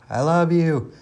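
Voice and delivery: put-on a girl calling into the valley; yelled. A narrator's reading. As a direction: shout it out into the valley. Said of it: "yelled Rebecca".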